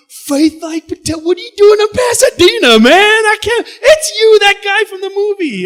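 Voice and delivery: very high, loud, slow starstruck squeal; starstruck shriek. Retold or imitated. Teller shrieking at the actor, very high and loud. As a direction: lose it over a celebrity. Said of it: "super excited".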